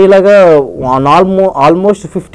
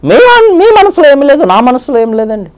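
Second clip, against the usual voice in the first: very high coy flirting woman voice; Chammak Chandra lady getup; 10 semitones higher than usual. Retold or imitated. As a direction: sweet-talk like a man in a saree, pitched way up. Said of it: "ఆయన voice తీసుకుంటే ఎలా ఉంటుంది". (చమక్ చంద్ర lady getup లో వయ్యారంగా ఎత్తైన గొంతు)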